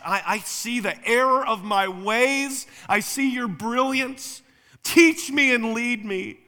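high, loud, drawn-out sarcastic surrender; hammed-up awestruck devotion, slow and ringing; mock repentance. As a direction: plead with exaggerated fake humility. Mocking an impossible meek reply to yelling.

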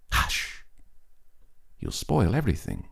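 hushed, urgent scolding; hushing girl. A narrator's reading. Girl hushing the know-it-all child, urgent hush. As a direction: shush them so the game isn't spoiled. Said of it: "some girl said".

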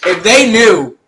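high loud teasing. Real, not acted. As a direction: tease loudly.

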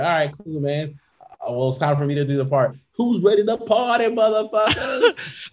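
rowdy party animal. Pretend. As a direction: rev up the crowd for the night. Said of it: "the moon's like".

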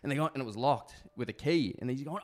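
usual speaking voice

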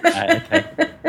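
giggling through the words; laughing. Real, not acted. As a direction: talk while still laughing. Laughing after Dalek joke.

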